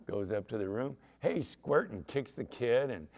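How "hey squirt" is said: higher-pitched mock taunt; bullying troublemaker. Eddie out of the parents' sight picking on the kid, bullying. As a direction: pick on a little kid. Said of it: "he's just a big troublemaker".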